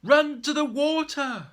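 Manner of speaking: high, loud urgent call; urgent shout. Acted. A voice in the fire urging everyone to run, shouting. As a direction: drive them toward the water.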